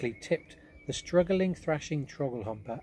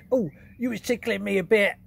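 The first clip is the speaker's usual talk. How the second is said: much higher, louder giant voice; tickled giant; 9 semitones higher, 9 dB louder than usual. Acted. BFG tickled.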